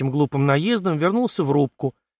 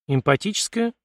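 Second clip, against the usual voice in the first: puzzled query, pitched up with a rising end; raised, questioning tone of mild astonishment; surprised echo; 7 semitones higher than usual. A narrator's reading. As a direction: repeat it back as a surprised question. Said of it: "удивлённо переспросил Артём".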